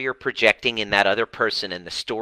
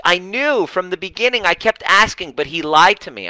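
mimicking Tina; 9 semitones higher, 4 dB louder than usual. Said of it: "He goes".

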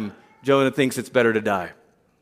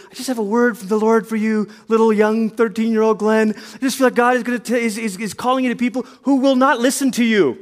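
higher, earnest prayer-minister voice; laying hands on a teen; prophetic prayer; 6 semitones higher than usual. Retold or imitated. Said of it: "the person praying for me had said".

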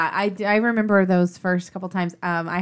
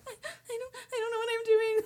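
much higher, hammed-up sob; mock crying; 9 dB quieter than usual. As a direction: whimper it like a helpless mom. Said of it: "And I'm like".

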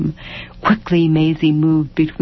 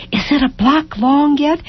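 put-on eager child; 9 semitones higher than usual. A narrator's reading. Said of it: "child asking eagerly".

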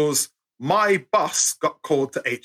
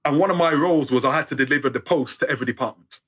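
ordinary talk

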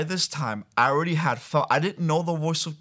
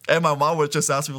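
ordinary talk

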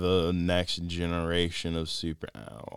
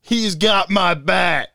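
slow, loud, pitched-up menace; booming trailer voice; 10 semitones higher, 8 dB louder, much slower than usual. Pretend. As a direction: drag every word out grandly. Mock trailer voice, Flash bit.

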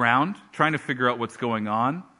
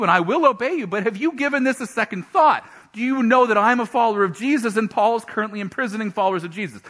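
higher, anxious pleading protest; nervous protest; 7 semitones higher, 3 dB louder than usual. Acted. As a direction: talk the Lord out of it, rattled. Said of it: "And Ananias goes".

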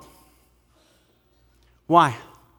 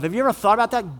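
ordinary talk

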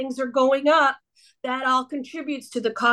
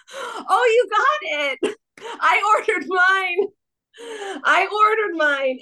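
excited; 8 semitones higher, slower than usual. Real, not acted. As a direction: burst out with delight. Excited reaction to the sticker.

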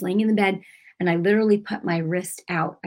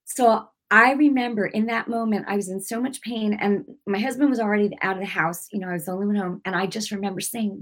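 normal talking voice

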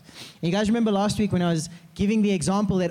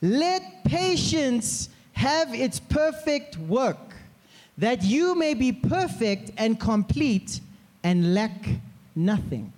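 scripture reading; 3 semitones higher, much slower than usual. Acted.

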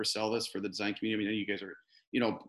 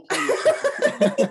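dissolving into high laughter mid-phrase; can't finish the sentence for laughing; 8 dB louder than usual. Real, not acted. Joking about planning a party together, breaking into laughter.

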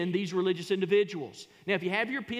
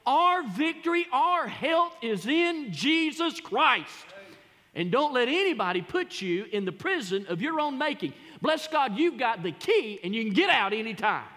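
preaching at full force; 5 semitones higher, 4 dB louder than usual. Acted. Preacher proclaiming victory to the congregation, loud and high. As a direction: shout the victory over the pandemic.

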